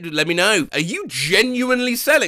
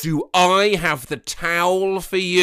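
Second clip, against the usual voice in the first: ordinary talk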